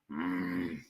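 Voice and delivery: chewing with a hum. Real, not acted. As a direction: mm while chewing. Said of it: "try not to chew with my mouth open".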